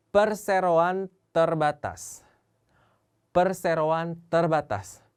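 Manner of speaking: perseroan terbatas drawn out very slowly; very slow careful. Shown as a demonstration. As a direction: stretch perseroan terbatas syllable by syllable. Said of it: "저도 발음하기가 힘드네요". (아주 천천히 또박또박 발음)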